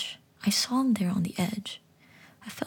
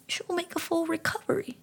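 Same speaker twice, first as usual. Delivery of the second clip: higher, gentle little frog; 10 semitones higher than usual. Acted. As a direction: coo it like a kind frog. Frog Solomon reassures.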